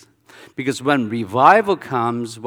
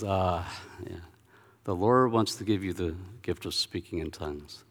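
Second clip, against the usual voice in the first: quiet pronouncement; 5 semitones lower, 9 dB quieter than usual. Retold or imitated. Retold minister's calm pronouncement.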